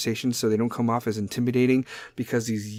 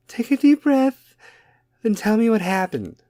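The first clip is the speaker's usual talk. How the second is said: Kim K; 10 semitones higher, 4 dB louder than usual. Acted. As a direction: fake celebrity comforting, high and loud. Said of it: "is going to be all Kim K".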